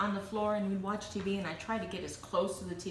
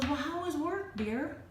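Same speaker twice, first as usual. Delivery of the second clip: lightly voiced sweet wife, a bit higher; imitating the wife, higher and sweet; wife's voice; 8 semitones higher, slower than usual. Wife greeting husband home from work, a light imitation. She goes.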